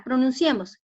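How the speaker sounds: ordinary delivery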